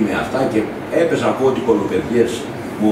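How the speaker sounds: ordinary delivery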